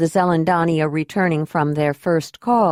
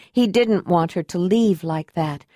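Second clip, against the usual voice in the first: ordinary delivery, nothing marked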